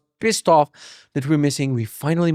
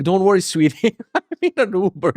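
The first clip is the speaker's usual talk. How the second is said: higher, fake-calm reassurance with a laugh; mock reassuring; 5 semitones higher than usual. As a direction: soothe her while laughing at yourself. Reassuring his wife on the phone.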